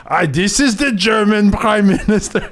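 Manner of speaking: high, slow, hammed-up German accent; mock German accent. Fake German leader answering the phone, in a high mock German accent. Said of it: "who does German?".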